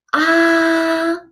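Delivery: "ah" held high and level; high flat tone. Shown as a demonstration. Teacher showing first tone, "ah" high and level. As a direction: sustain "ah" on one high pitch. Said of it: "It's a high flat tone".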